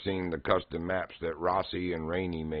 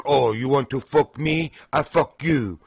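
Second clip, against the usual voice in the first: higher, louder imitation of her accented English; McStabby's accent; 6 semitones higher, 6 dB louder than usual. Retelling McStabby boasting to the clan in her accent. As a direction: mimic her broken English, playful.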